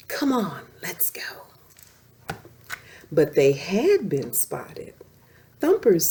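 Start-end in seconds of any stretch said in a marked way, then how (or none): 0.1-1.5 whispered, soft and breathless; urging friends on in a whisper. Acted. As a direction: sneak off in a whisper. Thumper whispering to go.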